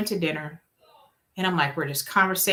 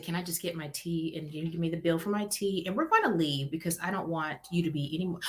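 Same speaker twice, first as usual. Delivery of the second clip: much softer, discreet; quiet polite request; 7 dB quieter than usual. Retold or imitated. Asking server quietly for bill.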